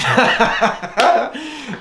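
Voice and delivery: high, loud burst of laughter; loud, high-pitched cackling laugh. Real, not acted. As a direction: crack up at your own joke. Laughs at own Irish accent.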